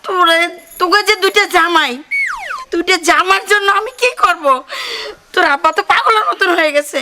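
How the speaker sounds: loud, high-pitched scolding; agitated complaint. Acted. Mother complains to daughter about sons-in-law, agitated.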